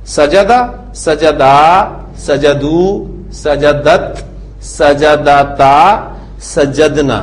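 सजदा, सजदू, सजदत said with a clear dental द before the ता ending; teacher stressing the d in सजदत; dal sound kept. Shown as a demonstration. Teacher drills conjugation so students hear the dal sound. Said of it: "आपको दाल की आवाज सुनाई दे रही है".

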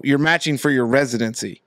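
ordinary talk

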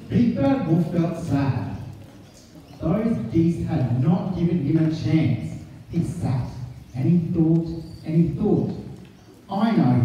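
put-on lively read-aloud voice for children; story narration. A narrator's reading. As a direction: read the picture book to the class. Teacher reads picture book aloud.

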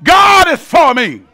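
building preacher's proclamation; full-force preaching. Real, not acted. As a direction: preach it like a rally cry. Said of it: "preaching myself happy".

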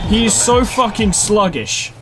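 high, slow, drawn-out frustrated complaint; exasperated groan. Real, not acted. Gamer losing a match, groaning slow and high at his sluggish character. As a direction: stretch it out in disbelief.

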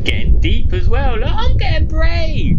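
high, loud and slow, forcing courage; nervous bravado. Real, not acted. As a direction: squeak out a brave claim you don't believe. Nervously swimming deeper.

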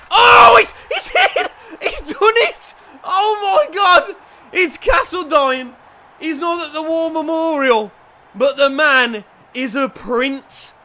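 real wild celebration; ecstatic goal celebration. Real, not acted. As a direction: lose it over the goal, laughing. Celebrating his player's goal.

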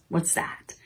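hushed, wondering question; curious whisper. Acted. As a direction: whisper like sharing a secret. Teacher whispers question to kids.

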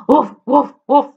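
playful woof like a dog; dog barking. Shown as a demonstration. Teacher barks like a dog for kids. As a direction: bark it out for the children. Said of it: "what do dogs say?".

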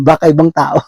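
punchline said through a laugh. Real, not acted. Preacher finishing the funeral joke, laughing. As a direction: laugh while delivering the punchline.

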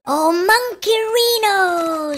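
normal talking voice

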